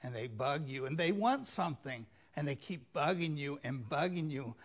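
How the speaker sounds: ordinary talk, nothing marked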